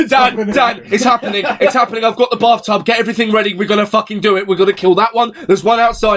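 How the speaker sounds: frantic urgency. Retold or imitated. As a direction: rouse a mate for the zombie fight. Acts out waking friend in panic.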